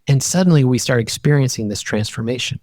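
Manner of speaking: usual speaking voice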